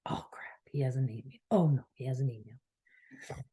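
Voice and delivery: hushed let-down, as if to herself; disappointed sigh. Retold or imitated. A woman finding no email yet, murmuring in soft disappointment. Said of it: "I'd feel disappointed".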